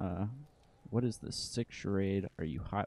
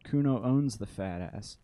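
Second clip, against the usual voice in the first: ordinary talk, nothing marked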